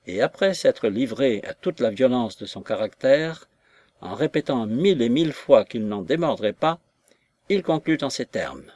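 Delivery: ordinary delivery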